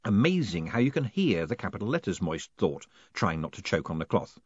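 ordinary delivery

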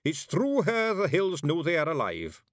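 high Scots, puffed up; boasting of their hag; proud Feegle. A narrator's reading. Rob Anybody proudly tells Miss Level in high Scots.

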